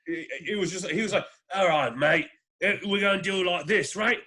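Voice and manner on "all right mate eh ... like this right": hammed-up British tough-guy accent; mock British geezer, laid on thick; over-the-top British accent. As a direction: overplay a matey British accent. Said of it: "he was so over the top".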